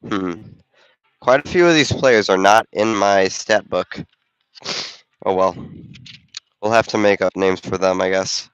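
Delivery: ordinary talk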